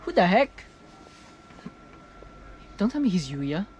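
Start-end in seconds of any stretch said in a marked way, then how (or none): none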